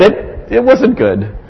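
ordinary talk

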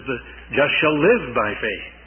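"live" carries the weight, falling after it; stress on live. Shown as a demonstration. Preacher quoting Paul to the Galatians, stress on "live". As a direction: hit the word "live". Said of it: "he put the emphasis on the life".

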